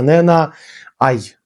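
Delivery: ordinary delivery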